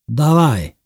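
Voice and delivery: short, high, loud command; shouted order. A narrator's reading. Guards send prisoners off with axes, shouting an order. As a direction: bark it as an order.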